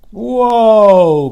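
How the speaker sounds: high, loud drawn-out whoa; startled whoa. A narrator's reading. Charlotte catching herself mid-spin, loud startled whoa. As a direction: catch yourself as the line swings.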